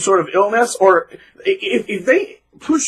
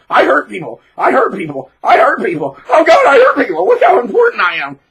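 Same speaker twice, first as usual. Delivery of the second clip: higher, louder laughing boast; mock gloating bully; 9 semitones higher, 6 dB louder than usual. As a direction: crow with cruel glee. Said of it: "he's like".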